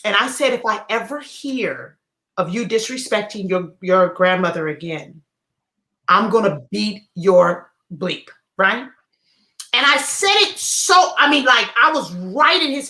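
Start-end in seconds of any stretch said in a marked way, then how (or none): 0.5-8.3 menacing threat. Retold or imitated. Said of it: "I got real close in his face".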